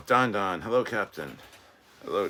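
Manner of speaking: ordinary talk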